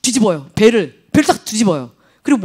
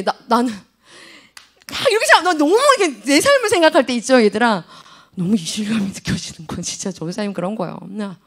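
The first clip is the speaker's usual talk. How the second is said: usual speaking voice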